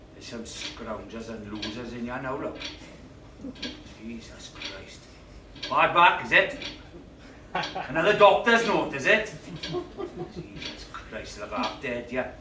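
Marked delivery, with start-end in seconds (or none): 0.1-5.0 soft, low grumble; grumbling mutter. Acted. 10.3-12.3 slow, soft grumble; slow mutter. Acted.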